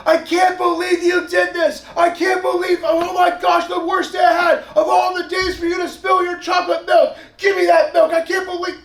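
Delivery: high, loud, slowed shouting rant; furious yelling parent. Pretend. Parent screaming at child over spilled chocolate milk, furious shout. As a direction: blow up like a volcano at the kid. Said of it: "We tear it into you".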